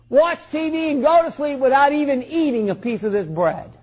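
fervent pulpit exhortation; impassioned preaching. Real, not acted. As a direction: press the congregation with urgent conviction. Preaches with rising fervor.

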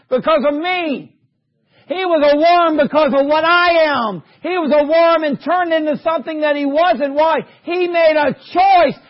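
high, loud preacher's climax; impassioned preaching. Real, not acted. Preacher telling congregation why Christ became a worm, impassioned shout.

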